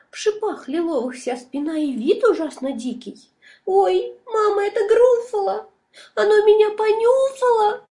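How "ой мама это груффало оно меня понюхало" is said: high, loud panicked cry; terrified squeal. A narrator's reading. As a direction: shriek it out in real fright. Mouse sees real Gruffalo.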